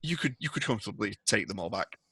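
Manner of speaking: ordinary talk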